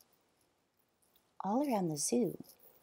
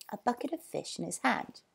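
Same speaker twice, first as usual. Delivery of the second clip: plain reading voice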